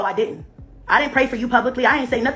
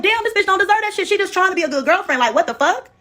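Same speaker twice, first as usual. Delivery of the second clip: quoting her own reaction; 10 semitones higher than usual. Retold or imitated. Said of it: "And I was like".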